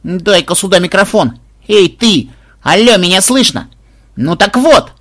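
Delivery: put-on feisty kid calling down the line; indignant hailing. A narrator's reading. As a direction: take charge of the microphone loudly. Indignant granddaughter grabs the microphone.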